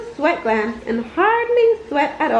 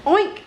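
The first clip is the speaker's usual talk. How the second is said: short squealing oink, pitched up; mock pig oink; 5 semitones higher than usual. Narrator imitating a pig, with a playful oink.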